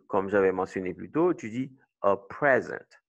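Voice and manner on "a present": "a present" stressed a PREZ-ent, falling at the end; stress on first syllable. Shown as a demonstration. Teacher recalling the noun example, first-syllable stress on "present". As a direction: put the weight on PREZ in "present". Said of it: "La première syllabe est accentuée".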